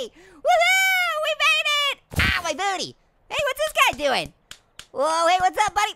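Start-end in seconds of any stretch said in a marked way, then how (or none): 0.0-2.0 high shouted victory cheer; excited whoop. Real, not acted. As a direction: whoop it out triumphantly. Celebrating a landing.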